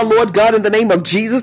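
usual speaking voice

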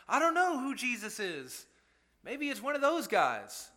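high, timid voice pretending not to know Jesus; mock cowering. Jesus mocked as cowering from enemies, a timid high voice. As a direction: play a scared man hiding in the crowd.

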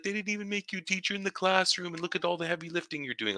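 put-on high, amused teen voice, fast at the end; teen student voice. Teacher imitating his grade eleven student, high and amused. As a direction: play the laughing student teasing his teacher.

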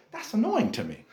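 ordinary talk, nothing marked

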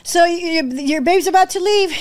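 urgent caller, emotion creeping in; urgent retold warning. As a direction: warn that time is running out. Retold urgent call, near tears.

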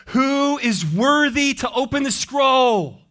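high, ringing shout with drawn-out words; loud angelic proclamation. Acted. Angel calling through heaven for someone worthy, loud proclamation. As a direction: proclaim it loudly and slowly to all creation. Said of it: "It's a loud voice".

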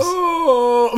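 sudden high squeaky crack; voice cracking up an octave. Real, not acted. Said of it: "my voice just cracked".

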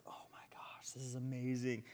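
hushed, whispered amazement; awed whisper. Retold or imitated. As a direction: whisper it to yourself, overwhelmed. Retells awe while reading alone.

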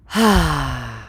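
long audible exhale; deep sigh. Real, not acted. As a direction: let out a deep breath. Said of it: "deep breathing feels so good".

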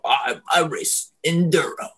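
mock snobby rider bragging; snooty racer. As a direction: say it like a smug show-off. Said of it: "'cause they're assholes".

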